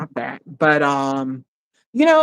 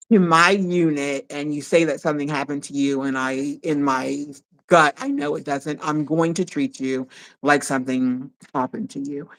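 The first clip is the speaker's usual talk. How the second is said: usual speaking voice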